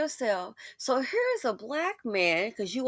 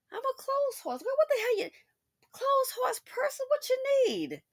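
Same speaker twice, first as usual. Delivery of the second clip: high put-on girlfriend voice; 3 dB quieter than usual. Voicing a woman offering clothes.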